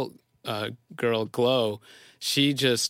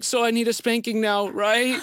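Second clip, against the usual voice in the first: put-on higher, small-child voice with a rising question; a father mimics his daughter asking if she's punished; imitating his little daughter; 8 semitones higher than usual. Dad voicing his ashamed little girl, higher.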